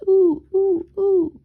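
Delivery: high, loud hooting ooh; owl's dragon hoot. A narrator's reading. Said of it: "shouted in a very loud voice".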